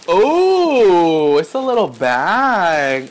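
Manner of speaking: loud high cooing, stretched out; delighted discovery. Real, not acted. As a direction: coo loudly over a surprise. Delighted discovery while unboxing.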